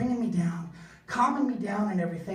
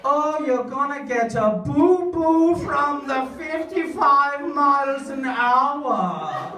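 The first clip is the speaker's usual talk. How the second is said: mock German uncle; 3 semitones higher, 6 dB louder, slower than usual. German in-law mocking American speed limits, slow singsong. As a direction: tease like a smug European in-law.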